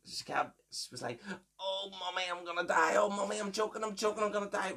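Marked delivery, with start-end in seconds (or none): 1.6-4.7 high, wailing choking-granny impression; Granny Margaret. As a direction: mimic an old woman pleading to her mommy. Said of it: "It's a very spot-on impression".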